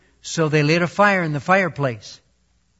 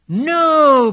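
loud, high cry of no; two pigs cry out at the wolf; 10 semitones higher, 7 dB louder than usual. A narrator's reading. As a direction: yelp out a frightened no. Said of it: "cried the pigs".

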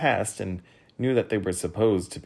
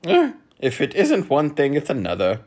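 loud, higher start, settling down; chiding; 6 semitones higher, 7 dB louder than usual. A narrator's reading. Minister chiding the squabbling guests, loud. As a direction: tut loudly in mild reproof. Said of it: "chided the minister".

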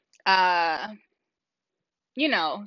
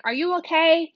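put-on retelling calling out to the lone little girl; calling down the hall; 7 semitones higher than usual. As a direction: call out to a child far down the corridor. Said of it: "yelled down the hallway".

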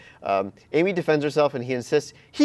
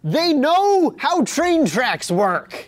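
slow, high incredulous emphasis; exasperated; 12 semitones higher, 5 dB louder, much slower than usual. Real, not acted. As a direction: spell it out in disbelief.

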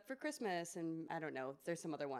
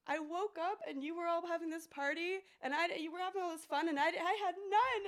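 much higher, whiny pretend sobbing voice; mock crying bear; 11 semitones higher, 4 dB louder than usual. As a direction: play a hammed-up weepy bear who missed the party. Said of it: "And he starts crying".

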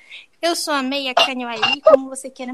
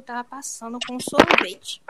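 normal talking voice

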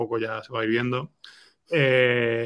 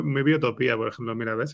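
ordinary talk

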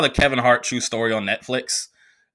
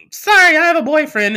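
high, loud sing-song sorry; mock a sweet polite rejection; prissy girl brush-off; 6 dB louder than usual. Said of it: "Why the fuck do I say it like that".

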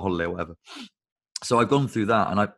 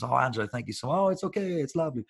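gushing diner; 5 semitones higher than usual. Acted. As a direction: play a delighted customer thanking the waiter. Gushing diners thank their waiter.

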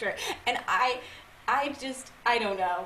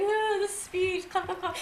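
mock polite; 10 semitones higher, slower than usual. Retold awkward moment at dinner.

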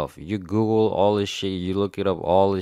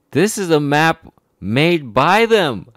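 raised, higher, exasperated voice; real indignation; 7 semitones higher, 6 dB louder than usual. Real, not acted. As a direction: hammer it out, indignant and loud. Worked-up rant about the map.

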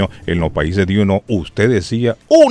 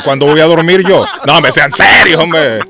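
higher, louder joking protest; mock exasperation; 5 semitones higher, 4 dB louder than usual. Real, not acted. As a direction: pretend to be fed up. Host jokes about three-hour show.

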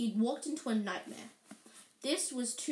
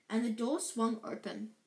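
ordinary delivery

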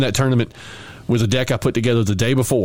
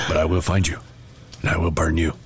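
low, grave movie-villain threat; terrible Liam Neeson threat to a card; Liam Neeson impression; 4 semitones lower than usual. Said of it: "I do a terrible Liam Neeson impersonation".

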